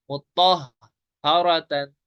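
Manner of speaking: मुत्तहहारतन said very slowly, each letter clear; teacher reciting an example; slow recitation. Shown as a demonstration. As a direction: drawl out मुत्तहहारतन carefully. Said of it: "ता है यहाँ पे".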